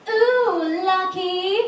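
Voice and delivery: high, sing-song ooh, lucky; a starry-eyed teen cooing; lightly voiced chirpy nineteen-year-old; naive teen envy. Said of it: "says something like".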